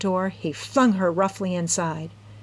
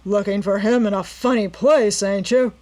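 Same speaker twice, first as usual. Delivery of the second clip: louder, mocking sneer; 2 semitones higher, 6 dB louder than usual. A narrator's reading. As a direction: sneer at her excuse. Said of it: "sneered the man".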